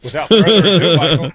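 loud announcer intro; big hammed-up announcer call; announcer hype. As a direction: boom it out like a ring announcer. Hyping the guest intro.

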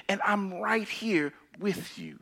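soft comforting whisper. Acted. Preacher voices Jesus assuring the grieving, in a whisper. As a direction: whisper it close, like a promise.

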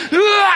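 high loud mock grunt; a short strained ugh; imitating her growl. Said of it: "she's like".

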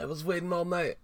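high-pitched put-on nagging voice; scolding girlfriend. Acts out angry girlfriend scolding Porky.

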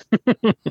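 bursts into a high laugh. Real, not acted. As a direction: laughs high and bright at the story. Laughs at his own story.

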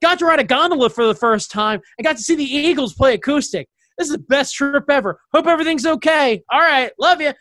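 a hint of a giddy father; excited dad. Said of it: "So he's like".

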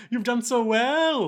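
high, slow patronising coo; drawn-out, high mock affection; doting praise. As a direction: coo it slowly, as if patting a pet. Imitating Joker petting his gimp friend.

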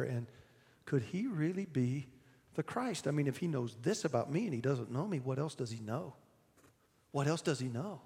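quiet, fast private musing; hushed wondering. Acted. The woman wondering to herself, quiet and hushed. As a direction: murmur it under your breath.

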